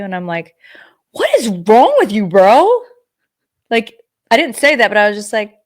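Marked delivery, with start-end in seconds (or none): 1.1-2.7 louder mock-offended comeback; indignant imagined retort. The woman retelling what she wanted to say to the bartender, a louder mock-offended retort. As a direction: bark it at him, offended but playful.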